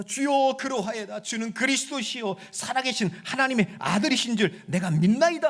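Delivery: loud, soaring sermon delivery; full-force preaching. Acted. Preacher proclaiming victory over death, loud and soaring.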